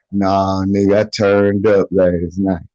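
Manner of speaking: low, offhand mock excuse; nonchalant friend. Friend admits he partied instead of going to court, nonchalant. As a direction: drop the voice for a shrugging friend.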